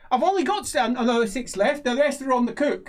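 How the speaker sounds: high-pitched, eager little trader; halfling merchant. Acted. Halfling merchant explaining his stock, high eager voice. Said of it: "He says".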